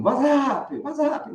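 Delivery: high, slurred "WhatsApp" as one word; run-together greeting. Retold or imitated. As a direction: imitate the ad's high joined-up call. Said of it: "Eles juntam". (imitando "WhatsApp" emendado e agudo)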